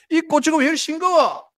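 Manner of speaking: high-pitched mock complaint; exaggerated indignant griping; complaining. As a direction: imitate a grumbler, voice pushed way up. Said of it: "이렇게 또 불평하는 사람이 있어요".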